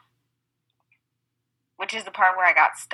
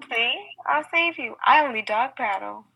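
mock rescuer; 6 semitones higher than usual. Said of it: "continues pool scene".

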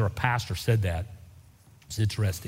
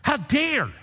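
high, indignant mock rant as Saul; Saul's indignation; 8 semitones higher than usual. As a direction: voice Saul's outraged pride. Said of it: "he just got madder".

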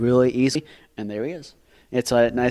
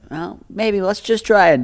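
much higher, tentative suggestion; mock hopeful, pitched way up; doubtful dad; 9 semitones higher, 4 dB louder than usual. Dad humours child about a missed deer, high and doubtful. Voices dad's doubtful encouragement.

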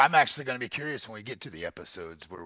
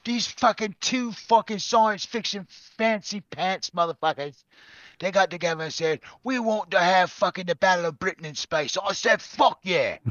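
horrible Cockney; 11 semitones higher, 11 dB louder than usual. Pretend. Host retells history as a sweary shouted Cockney. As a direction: yell the tale like a hammed-up Cockney storyteller. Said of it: "it's gonna be a horrible Cockney accent".

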